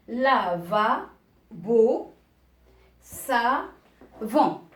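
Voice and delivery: slow teacher's word list, pauses between words; reading words aloud. Shown as a demonstration. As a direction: read each word out, drawn out and separate. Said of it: "je vais lire ces, ces mots". (lecture lente des mots, bien détachés)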